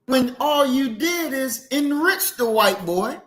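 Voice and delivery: normal talking voice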